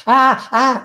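loud, high fake scream of being shocked; hammed-up electrocution yell; mock electrocution scream. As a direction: yell as if jolted by current. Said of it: "finge que se está electrocutando y grita".

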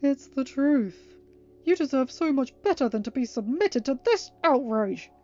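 indignant Discord, forceful and theatrical; growled outrage. Acted. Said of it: "he growled".

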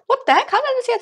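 high, startled exclamation; surprised. Real, not acted. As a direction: blurt it out in shock. Sudden surprise at free gems.

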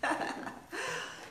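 high, unvoiced little laugh; airy high laugh. Real, not acted. Host laughing at wanting one of each color, airy. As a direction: laugh at your own greed.

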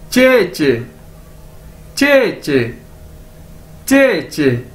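"चे चे" modelled with a falling tune; careful language-drill "चे चे"; demonstrated pronunciation. Shown as a demonstration. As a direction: repeat "चे चे" slowly for learners, pausing between takes. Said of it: "दो बार इसको पढ़ेंगे".

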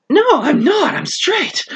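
a lightly voiced woman snapping back; Prudence, indignant. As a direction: Prudence answering Bruce's question on their date, high and indignant. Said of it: "from my performance".

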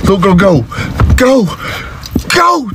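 high, urgent calls to flee; panicked warning. Acted. Dad warning little girl to run, high panic. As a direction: urge her away, voice climbing.

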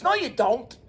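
ordinary talk

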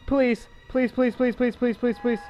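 loud, high frantic begging; terrified pleading. Real, not acted. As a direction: scream please in real terror. Terrified during a jump scare.

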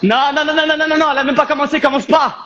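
host objecting as partner says session is over; playful protest. Real, not acted. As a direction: cut him off in mock alarm. Protesting co-host ending session early.

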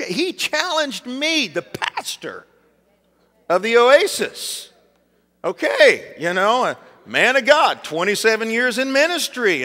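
high, drawn-out offended pride; hammed-up affronted pastor; mock indignation. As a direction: puff up with self-importance, stretching key words. Preacher mocks his wounded pride.

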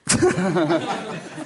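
sudden high laugh before answering; amused laugh; real laugh. Real, not acted. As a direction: burst out laughing at the memory. Asked about Brando film.

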